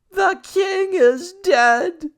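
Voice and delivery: very high, loud, slow scream; shrieking girl. A narrator's reading. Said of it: "A girl screaming and then shouting".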